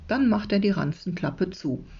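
usual speaking voice

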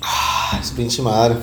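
breathy exhale after drinking; while sipping a drink. Real, not acted. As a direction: gasp after a sip of water. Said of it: "tomarme un traguito de agua".

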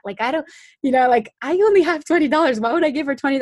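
can't believe what you're told to do; incredulous inner voice. Retold or imitated. Inner reaction to God's instruction.

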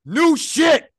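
loud high hype shout. Retold or imitated. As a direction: yell it like a hype man. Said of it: "my man [name]".

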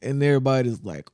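ordinary talk, nothing marked

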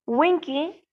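soft, gentle address; kindly. A narrator's reading. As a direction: coax the frightened elf softly and kindly.

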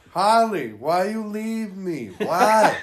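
hammed-up anguished wail, loud and high; Tommy Wiseau. Pretending the actor plays the Joker, hammed-up anguish. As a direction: overact a heartbroken plea, slow and swooping. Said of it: "he should be the Joker".

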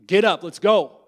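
loud, high, rough command; jailer's bark. Acted. Jailer kicks the prisoner awake, barking the order. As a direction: wake the prisoner with a shout.

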